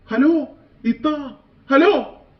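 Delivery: loud, high, drawn-out hello; frightened calling. A narrator's reading. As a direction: call desperately down the phone. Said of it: "നൂൽ phone എടുത്ത് വിളിച്ചു".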